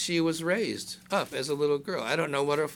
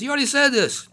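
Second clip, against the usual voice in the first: much higher, louder exasperated cry; mock exasperation; 12 semitones higher, 8 dB louder, faster than usual. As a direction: play God fed up with repetition. God voiced, exasperated joke.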